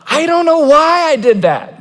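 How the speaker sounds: mild mock exasperation; exasperated confession. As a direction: sheepishly admit you can't explain it. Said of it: "Jeremiah would say".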